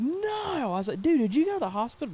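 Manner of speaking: very high-pitched mock-horrified cry; acted dismay. Bandmate hears he punched out the glass, dismayed.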